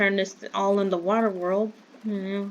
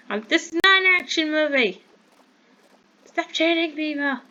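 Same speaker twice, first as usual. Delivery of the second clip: exasperated; 8 semitones higher than usual. Real, not acted. Scolding the shooters in game.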